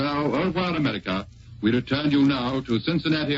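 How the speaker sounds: ordinary talk, nothing marked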